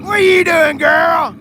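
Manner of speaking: loud, high mock scold at the car; playfully yelling at the car like a pet.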